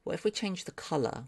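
ordinary talk

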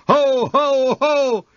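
high, loud jolly ho-ho-ho; booming Santa laugh. A narrator's reading. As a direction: let the department-store Santa boom it out. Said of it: "I heard a booming".

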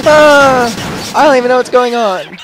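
loud high startled confusion. Real, not acted. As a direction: react as if frightened. Startled by scream.

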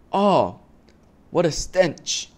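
high, slow disgust at the smell. A narrator's reading. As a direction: recoil from the stench.

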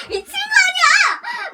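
high, drawn-out cry of disbelief; indignant yell. Real, not acted. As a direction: wail it slowly in outraged disbelief. Outraged at the edit.

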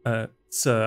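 ordinary talk, nothing marked

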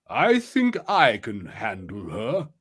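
slow, drawn-out character voice; lightly voiced confident companion, taking his time; self-assured old ally. Zarkon reassures the hidden player, slow and self-assured.

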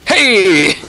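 shout. Real, not acted. Shouting in game.

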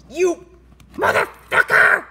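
loud, slow angry yell; furious shout. Acted. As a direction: curse him out in rage. Furious kaiju.

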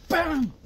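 sudden strike sound; loud bam. Shown as a demonstration. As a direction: punch the word out as the strike lands.

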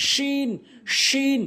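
শিন with strong sh and long "ee"; mid-tongue to palate, stretch শিন to "sheen"; correct শিন. Shown as a demonstration. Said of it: "সঠিক উচ্চারণ হবে".

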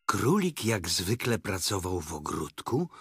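ordinary delivery

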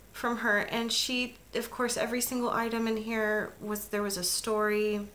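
ordinary talk, nothing marked

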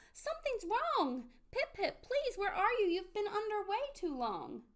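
high, alarmed mother's call; worried cry. A narrator's reading. Mommy calls for Pip-Pip under the water, crying out in alarm. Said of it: "then she cried out".

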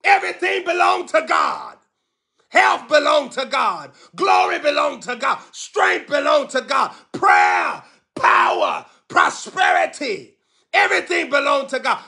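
shouted declarations, high and fierce; preaching at full force. Real, not acted. Preacher shouting declarations over the congregation. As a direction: proclaim each line like a battle cry.

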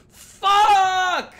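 very loud, high drawn-out shout; frustrated yell. Real, not acted. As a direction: scream it out in sudden dismay. Realizes a mistake in the game.

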